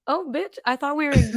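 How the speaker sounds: high sassy clapback; sassy retort. Retold or imitated. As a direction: throw it back with sass. Sassy reply to the hecklers.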